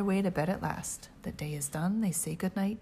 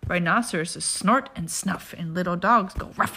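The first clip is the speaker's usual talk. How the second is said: ordinary delivery, nothing marked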